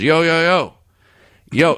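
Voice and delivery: high, loud urgent hail; calling out. Acted. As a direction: shout after someone walking away. Boss runs out calling after quitter.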